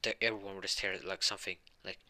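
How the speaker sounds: usual speaking voice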